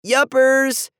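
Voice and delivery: high, loud cheerful yell; yelling back. A narrator's reading. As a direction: shout up a cover-up answer. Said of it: "Andy yelled back".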